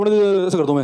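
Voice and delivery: usual speaking voice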